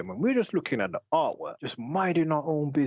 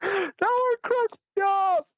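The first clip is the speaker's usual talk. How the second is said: laughing hard; slower than usual. Real, not acted. Still laughing after the joke.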